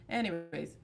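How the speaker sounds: ordinary talk, nothing marked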